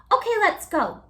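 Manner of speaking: high, loud eager cry; excited cry. A narrator's reading. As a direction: call it out eagerly. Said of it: "cried Pip".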